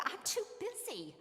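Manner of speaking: put-on high, breezy teen brushing off a worried parent; carefree busy student. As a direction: toss it off like a kid having too much fun to call home. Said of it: "And they're saying".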